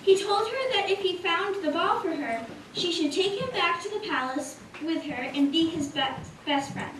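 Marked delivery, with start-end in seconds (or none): none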